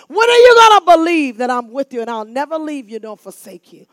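usual speaking voice

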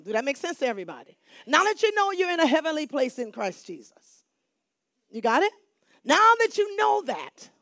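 usual speaking voice

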